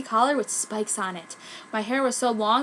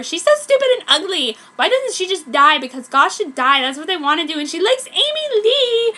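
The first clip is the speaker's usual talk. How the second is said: put-on much higher, louder catty prep girl; high-pitched preppy voice; 9 semitones higher, 9 dB louder than usual. Mean prep girl mocking the goth in a high-pitched preppy voice. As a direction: sneer it like a mean popular girl. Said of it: "in a high-pitched preppy voice".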